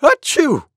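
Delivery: short, loud, high mock sneeze; monkey's sneeze. A narrator's reading. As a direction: burst out a quick acted sneeze. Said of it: "went Monkey".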